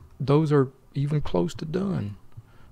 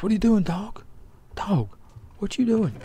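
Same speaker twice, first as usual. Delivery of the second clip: a friend's shrill challenge across the room; raised voice; 8 semitones higher than usual. Acted. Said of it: "don't be raising your voice like that".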